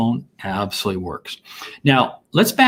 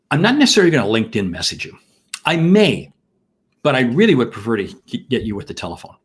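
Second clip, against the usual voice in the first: normal talking voice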